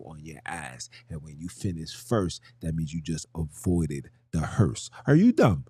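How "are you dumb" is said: loud, high "Are you dumb", sharp fall at the end; mock-incredulous high take. Catchphrase, high then low take.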